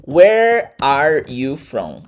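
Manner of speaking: "Where are you from?" very slow, "are" fully said; deliberate textbook "Where, are, you, from"; full word-by-word pronunciation. Shown as a demonstration. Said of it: "ao invés de falar".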